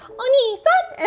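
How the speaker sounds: high, loud cutesy call of the big brother; roommate's call. Retold or imitated. Retelling a funny video to chat, imitating the roommate's high cry. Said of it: "then the roommate goes".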